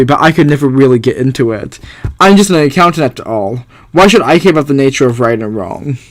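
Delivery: ordinary talk, nothing marked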